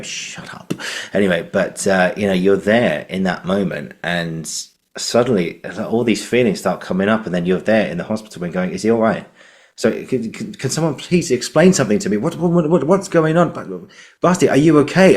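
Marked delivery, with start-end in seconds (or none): 0.0-0.7 whispered, drawn-out shush into words; hushed silencing. Acted. Actor shutting down doubting inner voice, whispered. 8.7-15.2 high, stammering, urgent plea; acted panicked parent. Parent in hospital asking after injured son, acted panic.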